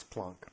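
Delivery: Planck said the German way, as "Plunk"; German pronunciation. Shown as a demonstration. Co-host correcting the presenter on Planck, German pronunciation of "Plunk". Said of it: "It's probably not Planck".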